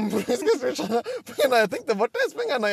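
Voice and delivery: loud, high, flustered retelling; half-laughing outburst of helplessness; laughing mock panic. Retold panic about refund, laughing. (skrattande låtsaspanik, högt)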